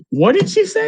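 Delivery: very high, squeaky mock astonishment; stunned disbelief. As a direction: gasp it out in total disbelief, way up high. Kids stunned by her words.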